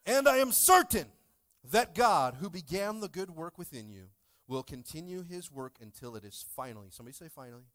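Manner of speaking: loud, emphatic reading of the verse; scripture reading. Acted. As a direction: proclaim the verse to the church. Reads scripture aloud.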